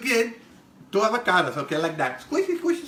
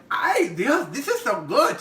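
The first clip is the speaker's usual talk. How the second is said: higher, excited "Ay, Dios, this is so good"; imitating friend's delight; 6 semitones higher, 4 dB louder, slower than usual. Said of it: "And she's like".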